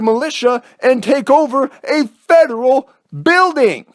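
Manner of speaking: each "don't" hit hard and high; stress on DON'T. Acted. Ranting at armed occupiers, stressing "don't" each time. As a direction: lay into the occupiers, punching every "don't".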